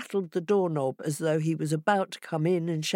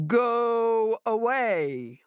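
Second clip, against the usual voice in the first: big drawn-out boom, pitched up; booming monster voice; 6 semitones higher, much slower than usual. Acted. Monster inside the house warns Hare off, booming. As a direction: boom it out slowly, stretching each word. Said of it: "a large voice came out from the inside and boomed".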